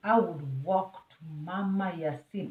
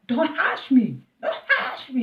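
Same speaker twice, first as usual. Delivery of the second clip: raised, louder mock snap at the husband; retelling an argument, "don't hush me" snapped loud; angry retort; 6 semitones higher, 7 dB louder than usual. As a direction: throw it back at him, heated. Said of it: "I'm like".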